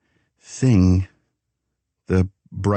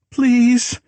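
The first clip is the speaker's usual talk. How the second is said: very high, louder desperate please; begging; 4 dB louder than usual. A narrator's reading. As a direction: plead with everything she has. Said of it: "she begged".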